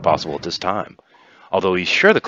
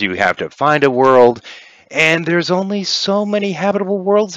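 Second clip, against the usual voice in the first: higher, emphatic, condescending negotiator; scheming Kiva; 7 semitones higher, 6 dB louder than usual. Acted. Kiva tells players evacuation is impossible, condescending. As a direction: lay out the obstacles with relish, stretching key words.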